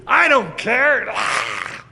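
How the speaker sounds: loud, high shout ending in a rough unvoiced roar; acted furious defiance. Yeshua answering the temple hecklers, acted furious defiance. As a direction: shout them down, then roar.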